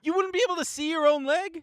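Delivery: high, incredulous teasing; teasing disbelief. Real, not acted. Friend teasing her about the sunglasses, incredulous and high. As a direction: poke fun at the excuse.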